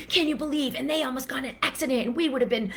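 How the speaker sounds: gasp, then high, fast, breathless gossip; dramatic retelling. Retold or imitated. Retelling the near-accident to a friend, hammed-up excitement. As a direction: spill the near-accident story with mock drama. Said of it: "make a drama about it".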